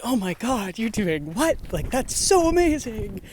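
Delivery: high, gushing amazement; excited gushing. Retold or imitated. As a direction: gush like an impressed stranger. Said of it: "day hikers are like".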